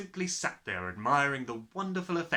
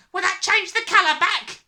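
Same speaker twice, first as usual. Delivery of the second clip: high, loud, anxious question; anxiously; 13 dB louder than usual. A narrator's reading. Father hoping soap will help, anxiously. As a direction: ask it in a panic. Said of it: "the father said anxiously".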